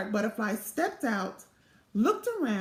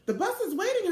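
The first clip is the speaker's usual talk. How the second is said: calling out; 7 semitones higher, faster than usual. A narrator's reading. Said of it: "her teacher called".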